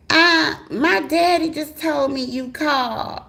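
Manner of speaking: put-on high, slow young girl. Young girlfriend introduces herself, high and slow. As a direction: play the timid teen caller. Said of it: "girlfriend on the phone".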